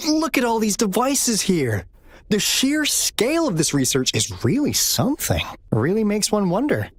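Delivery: high, eager wonder at the machines; excited awe. Acted. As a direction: sound thrilled by what you found. Amazed at the research devices.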